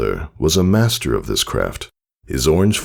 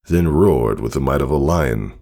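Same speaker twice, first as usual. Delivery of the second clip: ordinary delivery, nothing marked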